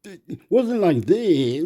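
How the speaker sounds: high-pitched mock protest; protesting bloke. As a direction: imitate a bloke going whoa, drawn out and high. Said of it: "the bloke was going".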